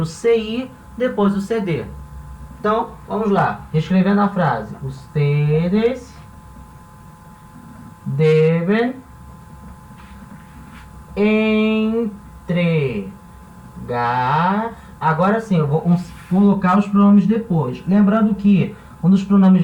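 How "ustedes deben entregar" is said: words drawn out with long pauses; ditado pausado. Shown as a demonstration. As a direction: dictate slowly while writing. Escreve a frase, ditando devagar. (ditado lento, sílaba por sílaba)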